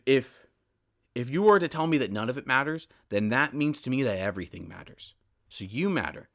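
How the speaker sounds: ordinary delivery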